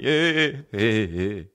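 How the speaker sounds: goofy mock giggle trailing the words; imitated laugh. Buddy laughing for no reason, imitated giggle. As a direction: mimic a friend tickled by nothing. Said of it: "He laughs at everything".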